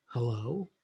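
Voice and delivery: low, soft, drawn-out hello; subdued phone hello. Shown as a demonstration. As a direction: answer the phone with little energy. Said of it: "whether the person says".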